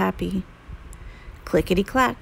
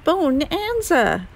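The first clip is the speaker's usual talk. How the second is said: delighted; 8 semitones higher, slower than usual. Acted. Delighted cry over good idea.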